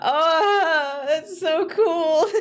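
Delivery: high, loud and slow delight; a drawn-out thrilled so cool; delighted awe. Real, not acted. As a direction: savour every word with wonder. Delighted by the animation.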